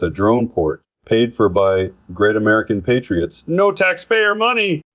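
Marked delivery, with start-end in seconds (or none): 3.5-4.8 much higher-pitched quoted boast; mock exclamation. Commentator quoting the host's boast about the East Wing, mock exclamation. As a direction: exclaim it in a high, sustained voice, imitating a boast.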